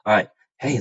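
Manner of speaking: ordinary delivery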